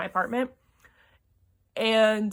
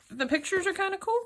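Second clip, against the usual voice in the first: delighted; 9 semitones higher, faster than usual. Real, not acted. First look at graphic novel.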